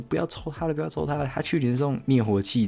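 high-pitched mock shriek of warning; imitating screaming. Siblings shrieking from the audience, a high mock scream. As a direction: squeal it like kids begging someone not to pick it. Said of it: "我们就一直在那边尖叫".

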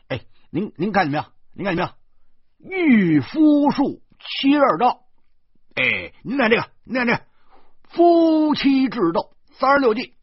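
high, loud street-hawker patter; lively sales pitch. Acted. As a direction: pitch it vividly to passers-by, punching the key phrases. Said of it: "生动地介绍着". (生动起劲地高声叫卖)

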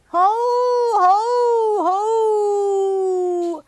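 high, loud lion roar; roaring cry. A narrator's reading. Narrator voices the lion's roaring cry from across the sea.

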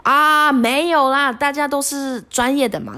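drawn-out no-big-deal reassurance; coach shrugging off. Retold or imitated. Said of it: "他说".

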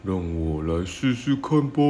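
ghost voice. A narrator's reading. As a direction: puff up like a proud ghost. Ghost boasts about his size.

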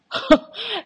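short, high burst of laughter; a sudden high laugh of disbelief. Real, not acted. Laughs at her own story. (高声大笑)

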